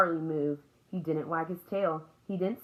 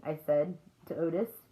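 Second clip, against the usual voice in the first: plain reading voice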